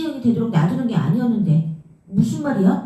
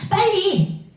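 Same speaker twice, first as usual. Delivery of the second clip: urgent cry of hurry; 11 semitones higher, much slower than usual. Acted. Sister urging her brother to come quickly, high urgent call.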